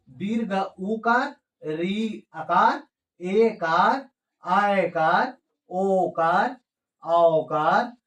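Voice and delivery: slow recited list, each name rising at the end; reading demonstration. Shown as a demonstration. Teacher modelling the vowel signs, slow sing-song recitation. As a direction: stretch out each sign's name separately, lifting its ending. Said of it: "इसको इस तरह से हम पढ़ेंगे".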